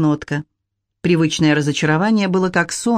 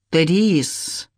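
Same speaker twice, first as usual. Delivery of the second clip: sharp. A narrator's reading. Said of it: "достаточно резкий".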